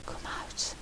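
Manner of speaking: urgent hushed whisper; whispering down into the hole. A narrator's reading. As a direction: breathe it without voice. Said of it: "Mello whispered".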